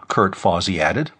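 ordinary delivery, nothing marked

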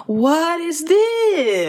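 high, drawn-out theatrical exclamation; Al Pacino impression. Quoting his film entrance, hammed-up outcry. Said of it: "and he says".

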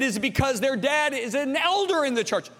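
high-pitched challenging question; dad grilling sons. Retold or imitated. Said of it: "I said".

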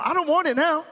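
high-pitched mock huff; offended refusal. Wife turns down husband's late offer, offended.

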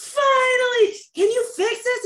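high, loud, near-tearful plea; desperate relief. Retold or imitated. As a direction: beg him to finally fix it. Begging the doctor in relief.

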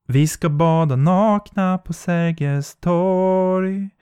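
slow, distant eerie lilt; high slow phantom voice; ghostly beckoning. Acted. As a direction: coax her toward the water.

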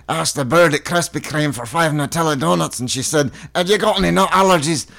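Scottish voice climbing high on the punchline; Scottish accent. Acted. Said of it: "This is the best accent work".

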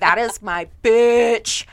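slow, loud, sassy claim; sassy declaration. Retold or imitated. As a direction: own it with swagger. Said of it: "I'm like".

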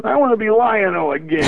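high heroic cartoon voice; bursting back into the young hero; Lion-O voice. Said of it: "but I can still do the voice".

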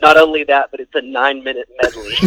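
ordinary talk, nothing marked

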